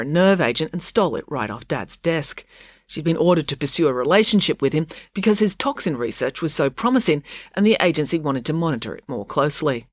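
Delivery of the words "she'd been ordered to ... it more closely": usual speaking voice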